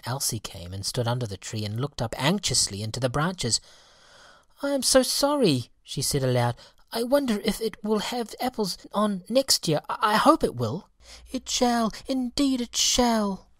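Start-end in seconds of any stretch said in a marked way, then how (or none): 4.6-10.9 put-on very high, sorry child voice; a child looking up hopefully; sad little girl. Elsie speaking to the bare tree, very high and sorry. 11.3-13.5 very high, firm promise; fairy cries out. Acted. Fairy crying out to Elsie, very high and eager. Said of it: "cried the apple fairy".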